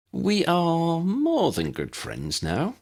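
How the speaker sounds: high, slow, beaming announcement. A narrator's reading. Demelza announcing the engagement, beaming and slow. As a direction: show off the good news. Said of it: "Demelza beamed".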